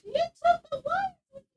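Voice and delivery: high and slow disbelieving cry; shocked. Real, not acted. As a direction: stretch out the shock.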